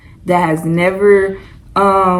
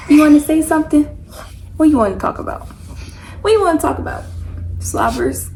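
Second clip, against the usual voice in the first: higher playful cooing; 8 semitones higher than usual. Real, not acted. Mom asking baby to talk.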